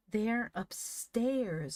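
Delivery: high, drawn-out scornful cry; scornful scream. A narrator's reading. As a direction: stretch it out with scorn. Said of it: "screamed Van in scorn".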